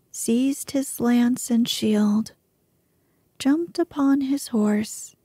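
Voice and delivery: plain reading voice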